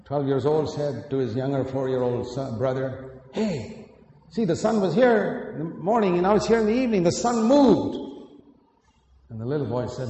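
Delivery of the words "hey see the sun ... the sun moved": a lightly voiced excited older boy, higher and louder. Older brother telling his little brother, lightly voiced boy. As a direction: play the twelve-year-old boasting the sun moved.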